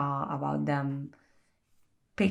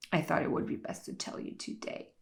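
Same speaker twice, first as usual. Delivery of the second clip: mock whisper; 10 dB quieter than usual. Toby's reply, recapped quote.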